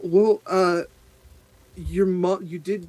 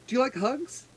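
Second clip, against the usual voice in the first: put-on childlike question; 10 semitones higher than usual. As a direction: ask sweetly like a small child. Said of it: "She goes".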